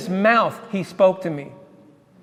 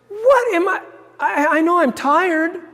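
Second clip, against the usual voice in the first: very high, slowing doubtful exclaim; startled disbelief; 10 semitones higher, slower than usual. Retold or imitated. Driver seeing the vanished man again, very high in disbelief. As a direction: doubt your own eyes.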